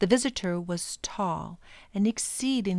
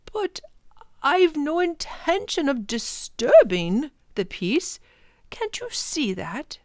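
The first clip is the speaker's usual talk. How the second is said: lightly voiced professor; 8 semitones higher, 5 dB louder than usual. Professor answers the Proc.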